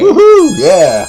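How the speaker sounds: high loud whoop; cheering. Real, not acted. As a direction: whoop it up for the fans. Cheering a viewer shoutout.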